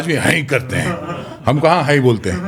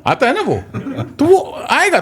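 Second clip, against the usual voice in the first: laughing; 13 semitones higher than usual. Real, not acted. Laughing while explaining accent.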